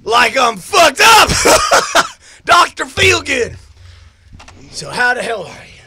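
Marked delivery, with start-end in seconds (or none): 0.0-3.6 put-on drunk whooping laugh. Red loudly celebrating how drunk he feels. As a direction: crow it out and cackle.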